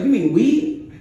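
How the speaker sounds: high, loud, amused disbelief; loud laughing realization. Acted. Barber finally gets it, loud and amused. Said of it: "And he's like".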